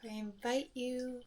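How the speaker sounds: soft, unhurried meditation voice with long pauses; soft calm phrases, spaced out by silence; guided meditation. Acted. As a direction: guide listeners gently through each breath. Leads a guided breathing meditation.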